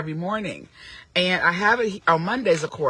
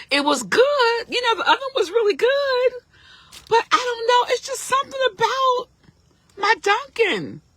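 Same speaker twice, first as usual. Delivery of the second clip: gushing high voice. Acted. Gushing over coffee, high voice.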